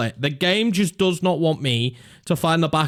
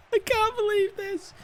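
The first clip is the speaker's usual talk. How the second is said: disbelieving laugh; 7 dB quieter than usual. Real, not acted. Laughing at the miss.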